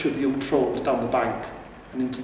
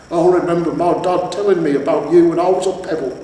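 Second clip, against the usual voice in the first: loud, raised, admiring; admiring troll; 4 semitones higher, 8 dB louder than usual. A narrator's reading. Troll telling the hero his dad's stories, gushing. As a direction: gush about the hero.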